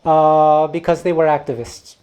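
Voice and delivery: ordinary talk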